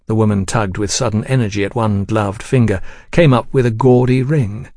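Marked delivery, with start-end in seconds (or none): none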